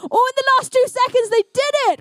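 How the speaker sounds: high-pitched thrilled commentary; excited play-by-play. Real, not acted. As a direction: call the last-second score in high excitement. Commentator calls a last-second score.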